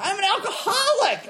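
very high, loud squeal with laughter; giddy schoolgirl. Acted. Newly enlightened drinker going giddy schoolgirl, squealing delight. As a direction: squeal it like thrilling news. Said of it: "I was this giddy little schoolgirl".

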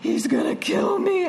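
high, wailing imitation of panic; mock crying. Retelling her crash, mock-crying in high panic. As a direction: wail it like a frightened drunk.

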